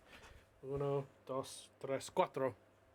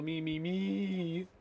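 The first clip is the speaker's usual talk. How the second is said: drawn-out insistence; 4 semitones higher, 4 dB louder, much slower than usual. Acted. Ron insisting he is guilty.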